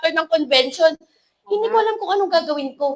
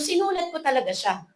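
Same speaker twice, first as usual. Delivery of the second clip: usual speaking voice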